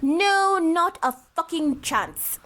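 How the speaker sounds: emphatic refusal, high then drawn out; crazy voice. Pretend. Co-host rejects repeating the parenting experience, crazy-voiced refusal. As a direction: stretch out a hammy, slow refusal. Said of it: "let me put my crazy voice away".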